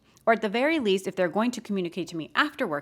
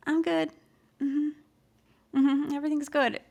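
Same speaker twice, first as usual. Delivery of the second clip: forced bright reply, much higher; fake cheerful; 9 semitones higher than usual. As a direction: paste on a fake smile for the answer. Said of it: "And you're like".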